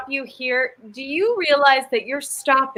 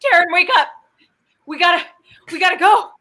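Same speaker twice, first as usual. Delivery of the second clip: urgent pleading; 6 semitones higher than usual. Acted. Urgently waking a dreaming friend.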